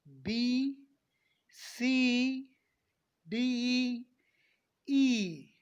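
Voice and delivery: बी, सी, डी, ई each long, held and separate; stretched letters. Shown as a demonstration. Teacher modelling letters for students, each drawn out. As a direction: drag out the ई sound in बी, सी, डी, ई. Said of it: "थोड़ा खींच के".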